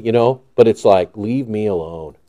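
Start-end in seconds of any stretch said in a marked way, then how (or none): 1.1-2.2 low, softly spoken weary brush-off; weary plea. Acted. To a loving but overbearing believer, weary plea. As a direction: ask to be left be, quietly and low. Voicing wish to smothering believer.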